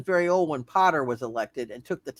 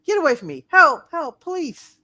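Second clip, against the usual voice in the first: much higher, loud cries for help; terrified scream; 10 semitones higher, 6 dB louder than usual. Acted. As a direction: scream for help, fading on please. Woman screams for help from stranger.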